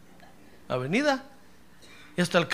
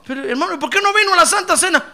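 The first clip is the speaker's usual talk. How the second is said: quick, high and louder reproach; exasperated pastor; 10 semitones higher, 7 dB louder, faster than usual. Retold or imitated. Pastor asking a brother why he missed communion, high and exasperated. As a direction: scold the absent brother in a rush. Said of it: "Tengo que estarle diciendo".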